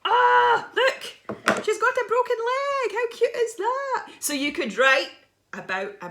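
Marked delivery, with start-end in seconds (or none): 0.0-4.1 very high, slow cooing voice; cooing cute voice. Acted. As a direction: gush over a tiny mouse. Showing the mouse with broken leg.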